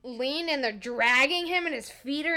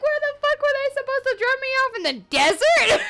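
high, loud, hammed-up protest; mock indignant yell; 14 semitones higher, 5 dB louder than usual. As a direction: squawk it in exaggerated disbelief. Retold indignant retort to mom.

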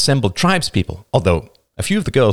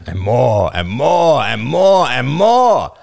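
mounting exasperation; 3 semitones higher, 4 dB louder, much slower than usual. A narrator's reading. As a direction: build it up, repeat by repeat. Roman mounting tired of debates.

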